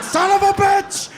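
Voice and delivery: high, loud, slow furious father; dad breaking up a fight, yelling; angry dad yell. Retold or imitated. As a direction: bellow at the kids.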